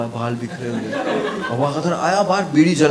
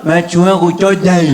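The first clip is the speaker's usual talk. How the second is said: louder, garbled mock of the uncle's boast; uncle's real mumble; 3 semitones higher, 8 dB louder than usual. Comic imitates how the uncle actually blurted his boast, loud and garbled. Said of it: "वो ऐसे नहीं बोला".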